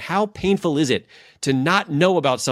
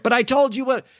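much higher mock outburst; mock exploding dad; 12 semitones higher than usual. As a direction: burst out like a frustrated father. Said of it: "would have been like".

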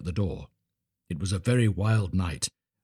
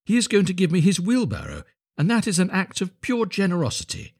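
high, earnest self-consolation; trusting little Hans; 10 semitones higher than usual. A narrator's reading. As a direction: reason it out naively, high-pitched.

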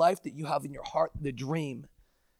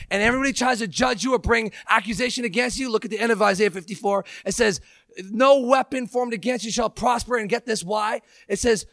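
higher, faster, louder preaching; preaching at full force; 5 semitones higher, 4 dB louder than usual. Acted. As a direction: drive it home like a revival preacher. Preaching builds up.

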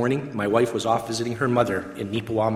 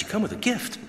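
higher-pitched, surprised "gift?"; surprised echo question; 9 semitones higher, 3 dB quieter than usual. Retold or imitated. Echoes officer's news in surprise.

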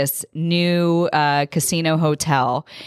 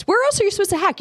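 excited; much faster than usual. Real, not acted. Excited venting about horse show.

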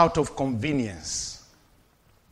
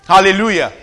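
usual speaking voice

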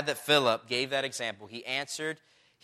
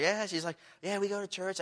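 put-on high, soft girl's reply; lightly imitated girl; 7 semitones higher, 4 dB quieter than usual. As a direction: lightly mimic a girl, pitched up and softer. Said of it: "there was a girl".

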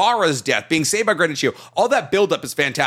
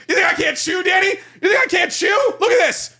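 mock indignant shout; 12 semitones higher than usual. Cohost mock shouting back at his cohost's jab. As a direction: huff it up, pretending to be offended.

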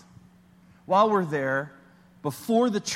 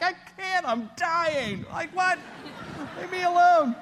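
much higher, strained cry of panic; panicked cry; 13 semitones higher than usual. Retold or imitated. To the flight attendant during a panic attack, panicked. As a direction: plead like you're dying. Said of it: "I'm like".

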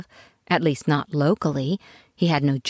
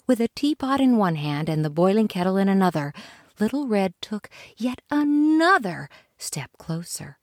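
ordinary delivery, nothing marked

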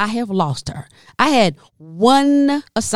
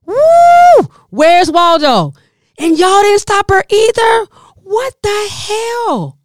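loud high disbelieving yell; 1 shouting at the staff; 11 semitones higher, 7 dB louder, slower than usual. Retold or imitated. Comedian yelling at hotel staff in disbelief. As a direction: yell it high at people who let her go.

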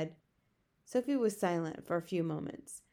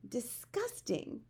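slow, higher, hushed revulsion; disgusted; 5 semitones higher, 5 dB quieter, much slower than usual. A narrator's reading. Sophie hears of the guzzling, disgusted. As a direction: draw it out in disgust.

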